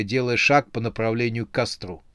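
plain reading voice

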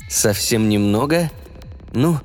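plain reading voice

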